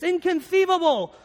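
high lisping villain exclamation; Vizzini impression. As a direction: pitch it up with a lisp. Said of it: "and he says".

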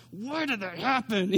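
imitating an outraged little girl without real volume; half-voiced, put-on screamy wail; mock child's scream. Daughter protesting after her shot, mock screaming. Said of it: "she starts screaming".

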